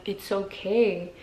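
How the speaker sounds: soft, slow and soothing; gently drawn-out comforting words; soothing reassurance. Retold or imitated. Touching his back to calm him, soothing. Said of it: "with this, like, demeanor".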